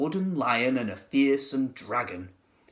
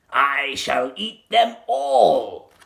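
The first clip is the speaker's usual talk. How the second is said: sly crocodile; 6 dB louder, much slower than usual. A narrator's reading. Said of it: "he said to himself".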